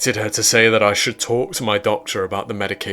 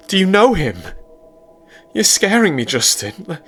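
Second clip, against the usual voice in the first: much higher, louder pleading; higher, louder frightened girlfriend; panicking girlfriend; 11 semitones higher, 5 dB louder than usual. A narrator's reading. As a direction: beg him, close to panic.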